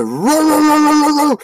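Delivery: high, loud mock roaring; imitated dog roar. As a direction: act out the dog lunging. Imitating the dog's attack.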